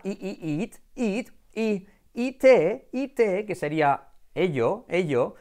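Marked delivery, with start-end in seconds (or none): 0.0-1.3 higher, long ee in "eat"; "i, i, eat" with a high, tight ee; Spanish I vowel. Shown as a demonstration. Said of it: "se pronuncia con una I española".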